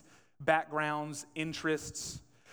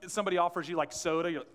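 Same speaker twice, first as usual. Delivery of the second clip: ordinary delivery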